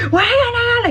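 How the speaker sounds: very high, loud yell; squealing yell. Retold or imitated. Mom yelling back at her scared kids, high shriek. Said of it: "I yell for everything".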